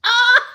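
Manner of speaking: very high, loud delighted squeal; real squeal of glee; excited squeal. Real, not acted. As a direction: shriek with joy. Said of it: "I'm so excited".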